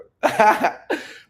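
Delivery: a high, bright laugh at his own joke; laughing at his own kiss joke to the viewer; playful laugh. Real, not acted.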